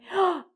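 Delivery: high, quick audible gasp. Real, not acted. Teacher about to announce a favorite color, gasping. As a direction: draw a breath in sharply.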